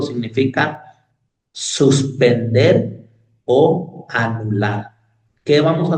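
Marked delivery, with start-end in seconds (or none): 1.5-4.9 "suspender" drawn out very slowly, "o anular" slow too; slow emphasis. Acted. Preacher driving home the meaning of Shabbat, slow stress. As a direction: spell out "suspender o anular" word by word.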